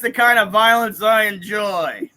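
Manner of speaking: loud, relishing mock quote; hammed-up loud endorsement; mock approval. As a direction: announce it loudly as someone else's boast. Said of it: "He's like".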